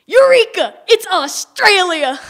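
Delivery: high, loud, slowed triumphant cry; excited shout. Acted. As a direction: shout it like a great discovery. Dad finds lost item.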